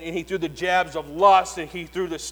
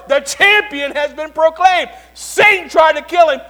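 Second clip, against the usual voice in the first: full-force preaching; 11 semitones higher, 15 dB louder than usual. Acted. Preacher proclaiming the resurrection to his church, triumphant shout. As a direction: shout the victory to the rafters.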